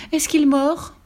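high, frightened question; alarmed exclamation. A narrator's reading. Frightened lady asks about the Warou, high alarmed voice. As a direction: ask it in a panicky high voice. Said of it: "s'écria une dame".

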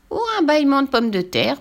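high, put-on granny voice; playful grandmother. A narrator's reading. Grandmother adding to the breakfast joke, playful.

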